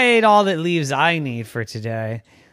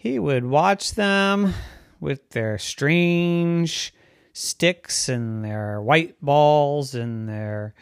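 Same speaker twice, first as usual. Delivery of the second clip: plain reading voice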